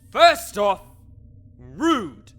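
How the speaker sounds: loud clipped put-down; curt scolding. Acted. Necromancer scolding the adventurer, curt. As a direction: dismiss them in one word.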